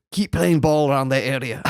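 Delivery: lightly attempted Scottish manager accent; rough Scottish accent, half laughing; Alex Ferguson. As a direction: have a go at a Scottish team talk.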